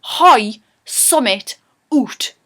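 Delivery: slow, punchy Geordie, word by word; broad Geordie. Shown as a demonstration. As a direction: hit each Geordie word hard with pauses between. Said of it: "We're really hit on it".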